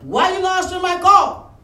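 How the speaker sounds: high, loud scolding question; mock scold of a member; imitated pastor scolding; scolding demand.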